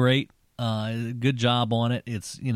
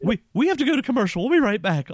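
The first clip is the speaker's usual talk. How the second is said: high, rushed, mock-flustered stammer; flustered schoolgirl impression; 11 semitones higher, 4 dB louder, faster than usual. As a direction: imitate a giddy host hurrying out. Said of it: "a sixteen-year-old schoolgirl at that point".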